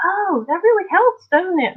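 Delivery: high impressed voice; people amazed. Retold or imitated. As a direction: gush like a convert. People going, retold.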